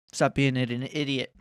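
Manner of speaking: ordinary talk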